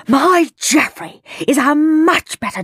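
a touch of a shout from an affronted parent; indignant shout. A narrator's reading. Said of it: "shouted another".

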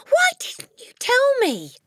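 put-on high, slow, loud child's reproach; hurt cry. A narrator's reading. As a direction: wail it at the teacher. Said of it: "Matilda cried".

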